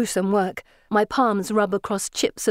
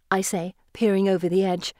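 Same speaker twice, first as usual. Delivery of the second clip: plain reading voice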